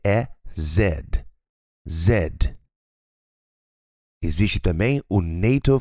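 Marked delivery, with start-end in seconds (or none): 1.9-2.6 Z pronounced "zed"; British Z. Shown as a demonstration. Teacher repeats for learners the British pronunciation, Z as "zed". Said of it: "Já no inglês britânico".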